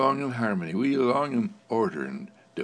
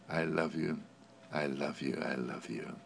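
tender fading love; 7 semitones lower, 9 dB quieter than usual. Real, not acted. Tender closing to the listener.

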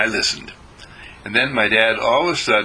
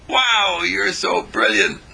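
admiring exclamation; 9 semitones higher, slower than usual. Acted. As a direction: cry out in admiration. People praising him.

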